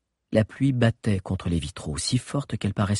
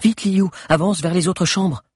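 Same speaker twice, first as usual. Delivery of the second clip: urgent; 10 semitones higher, 4 dB louder than usual. Acted. Agnès urges Liu.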